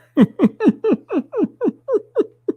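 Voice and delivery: long squealing laugh; a real fit of high laughter. Real, not acted. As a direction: crack up for real. Laughing at the video.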